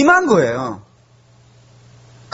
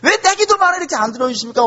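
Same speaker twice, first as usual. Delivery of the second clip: high, pleading protest against God; lightly acted frustrated outcry; angry complaint; 9 semitones higher than usual. The poet complaining to God in anger, mock-indignant protest. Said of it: "너무 화가 난 거예요".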